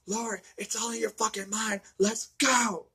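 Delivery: mock frantic plea to get out now; desperate Loomis. Loomis urging her to leave.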